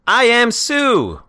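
loud, high, drawn-out yell; over-the-top outraged bellow; angry waiter shout. Acted. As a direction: shout it slowly, pitch way up. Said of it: "Waiter shouts, angry".

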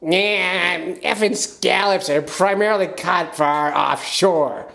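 high, slow, odd cartoonish Maine captain; Captain Sean. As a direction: do a weird Gilbert Gottfried from Maine. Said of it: "I'll do my impression of Captain Sean".

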